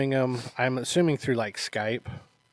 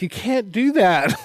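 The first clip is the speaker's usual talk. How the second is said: higher-pitched mock outrage; retold indignant protest; 7 semitones higher, 4 dB louder than usual. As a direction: protest it, imitating an indignant movie character. Retold indignant film reply.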